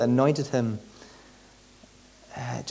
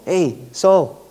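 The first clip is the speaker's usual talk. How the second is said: loud offhand shout of Saul's name; casual loud call; 5 semitones higher, 7 dB louder than usual. Retold or imitated. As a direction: call out cheekily across the distance. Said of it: "not the way I've just done it".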